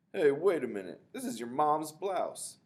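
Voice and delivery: high, loud and slowed outrage; hey, that's a blouse!; shocked, loud protest; indignant surprise. A narrator's reading. Shocked at being handed blouse.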